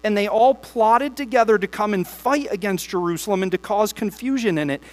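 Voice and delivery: steady, measured Bible reading to the church; congregation-facing scripture reading. Acted. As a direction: read the passage aloud with weight. Reads long Nehemiah passages.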